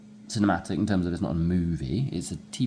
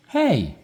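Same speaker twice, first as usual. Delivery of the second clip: hey! pitched up and louder; attention-getting hey; 8 semitones higher, 5 dB louder than usual. Shown as a demonstration. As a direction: call out hey! to catch attention. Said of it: "It gets your attention".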